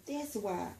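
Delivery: ordinary delivery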